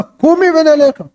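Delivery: high, drawn-out order; commanding. Acted. Husband ordering concubine instead of endearments, commanding. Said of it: "פקודה".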